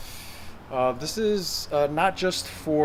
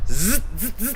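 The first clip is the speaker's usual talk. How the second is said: louder buzzing zzz on the last air; buzzing z sound; 6 semitones higher, 4 dB louder than usual. Shown as a demonstration. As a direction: buzz out a tight z. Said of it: "zip up your abs".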